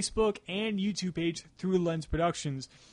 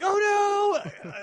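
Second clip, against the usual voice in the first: high squealing "oh no"; excited shriek; 5 dB louder than usual. Retold or imitated. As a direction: shriek it like a devastated fan. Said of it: "she's like".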